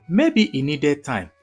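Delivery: usual speaking voice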